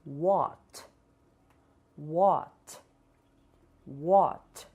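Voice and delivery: what ending in a clean t; hard English t. Shown as a demonstration. Teacher models what with hard final t. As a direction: finish what with a crisp t. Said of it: "Iremos pronunciar".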